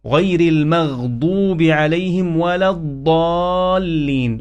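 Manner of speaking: slow recital, emphatic d in المغضوب and الضالين; heavy dad. Shown as a demonstration. Teacher modelling the ending, الضالين with emphatic d. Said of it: "ولا الضالين بالضاد".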